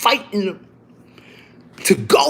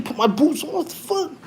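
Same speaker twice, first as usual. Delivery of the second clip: ordinary talk, nothing marked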